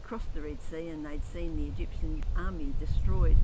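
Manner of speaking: loud, ringing preaching voice; preaching at full force. Acted. Preacher proclaiming the Red Sea song to the church, loud. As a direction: proclaim it to the back of the hall.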